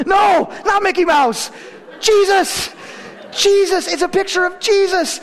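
mock despair of a failing father; exasperated shout. Father correcting daughter, exasperated.

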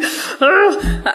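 a high, shaky sigh before the tears come; emotional sigh, holding back tears; tearful sigh. Real, not acted. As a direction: let out a choked-up breath. Emotional moment before tearful story.

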